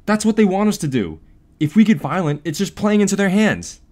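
put-on loud, urgent boy's argument; yelled warning. David warns newsies against violence, yelling. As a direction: argue loudly over a crowd. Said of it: "David yelled over their cheers".

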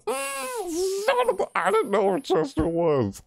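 high-pitched exasperated whine; upset whine. Real, not acted. Player caught by Chester, whining in dismay.

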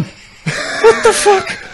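stunned. Retold or imitated. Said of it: "I was standing just going".

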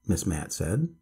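plain reading voice

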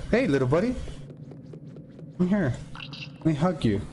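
high cooing voice for little creatures. Real, not acted. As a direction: coo at them like pets.

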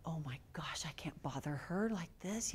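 soft unvoiced whisper, holding back; whispered thought. Seeing the mother writing on the grave: soft whisper. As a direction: whisper so as not to intrude. Not wanting to disturb grieving mother.

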